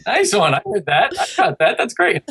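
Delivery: put-on high, bright cheering for a small child; over-the-top parent cheering; excited praise. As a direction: praise him like he just did something amazing. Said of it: "I'm like".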